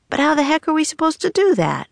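high, fast frustrated question; exasperated. A narrator's reading. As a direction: burst out in high-pitched exasperation. Jack is cross about impossible task.

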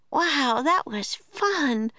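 put-on high, slow, delighted girl voice; Annie thrilled. A narrator's reading. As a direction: draw it out like a thrilled little girl. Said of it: "said Annie".

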